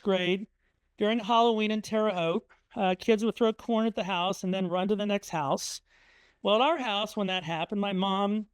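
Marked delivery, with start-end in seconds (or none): none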